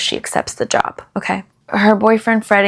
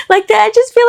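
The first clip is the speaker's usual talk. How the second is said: incredulous; 13 semitones higher, 4 dB louder, faster than usual. Real, not acted. Incredulous outburst about the scene.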